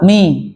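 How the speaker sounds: "Mi" with an i vowel, not ma; read as mi. Shown as a demonstration. Teacher prompting the student's next syllable, mi. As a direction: give Mi as one open syllable. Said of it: "Itu kayak gitu bacanya mi".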